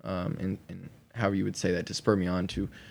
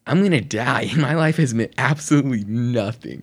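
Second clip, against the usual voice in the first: mock panic; 4 semitones higher, 6 dB louder than usual. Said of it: "I'm freaking out".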